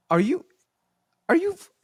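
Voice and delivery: can't believe what he's hearing; high, cut-off disbelief; incredulous. Retold or imitated. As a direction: voice jumps way up in disbelief. Sergeant's disbelief, retold.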